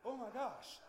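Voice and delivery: high, loud mock amazement; astonished. Villagers amazed by his lifting, astonished exclamation. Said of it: "it was just astonishing to them".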